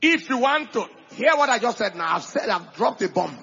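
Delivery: loud, emphatic sermon delivery; preacher exhorting the church; preaching at full force. Acted. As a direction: drive each line home to the congregation.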